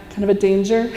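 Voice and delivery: normal talking voice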